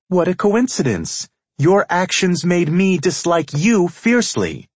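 very high, loud woman's retort; heated, high-pitched comeback; indignant female character. A narrator's reading.

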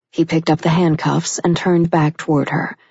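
usual speaking voice

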